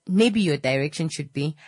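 usual speaking voice

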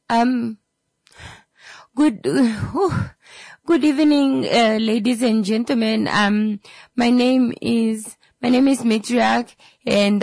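high, hesitant pilot stumbling over his own name; nervous pilot. Acted. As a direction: play an unsure pilot fumbling the cabin announcement. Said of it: "not exuding confidence at all".

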